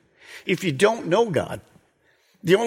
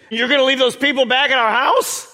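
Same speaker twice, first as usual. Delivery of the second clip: high, loud incredulous voice; disbelieving disciples; 10 semitones higher, 4 dB louder than usual. Acted. Disciples protest to Jesus in loud, high disbelief. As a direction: act stunned that he would leave them. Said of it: "imagine the disciples saying to Jesus".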